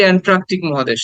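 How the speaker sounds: "অ্যান্ট্রাকটিক" with an r after t, as "ট্রা"; common mispronunciation. Shown as a demonstration. Teacher quoting how we wrongly name the continent, "ট্রা" for "টা". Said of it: "আমরা বলি".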